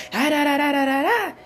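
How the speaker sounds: loud high mock nagging, da da da; loud mocking blah-blah rant; mock yelling gibberish. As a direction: imitate a woman going off, loud and high.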